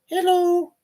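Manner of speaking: high, drawn-out little hello; cat's pretend voice. Owner voicing his cat for viewers, playful high voice. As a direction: greet the viewers as the pet in a high voice.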